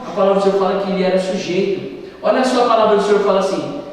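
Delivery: ordinary talk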